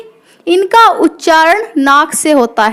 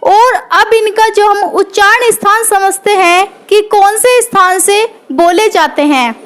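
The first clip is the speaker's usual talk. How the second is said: ordinary delivery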